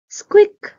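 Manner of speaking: high, loud little squeak; a tiny animal's squeak. Acted. Creature under the bed squeaking high.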